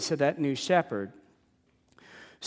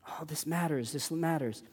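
clamoring voices; 8 dB quieter than usual. Retold or imitated. Voices of things clamoring for attention.

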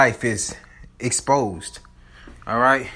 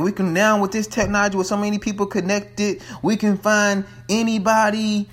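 higher-pitched imitated product pitch; lightly put-on presenter voice; imitating company presenters; 7 semitones higher than usual. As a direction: voice a presenter showing off new technology. Voices the company's demonstration to audience.

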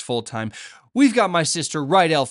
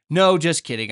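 normal talking voice

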